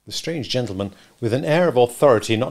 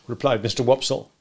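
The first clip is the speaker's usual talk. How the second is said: ordinary delivery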